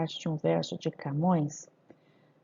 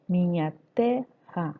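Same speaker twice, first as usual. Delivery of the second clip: "minha terra" slow, divided mi-nha-te-rra; measured syllables, "rra" held together; scansion; slower than usual. Shown as a demonstration. Teacher scanning the verse, "minha terra" slowly syllabified. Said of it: "Se eu fazer a escansão".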